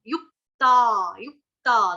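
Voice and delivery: युक्ता held long, slow and loud; slow recitation demo of युक्ता; drawn-out yukta. Shown as a demonstration. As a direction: stretch युक्ता with the full ता. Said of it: "दो आधे आधे क मेरे आ रहे हैं".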